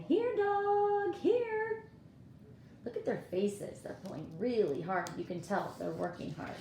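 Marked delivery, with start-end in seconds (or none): 0.0-1.8 high, loud call to the dog; daughter calling dog. Acted. Daughter calling the dog to help pull, high loud call. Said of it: "she's saying".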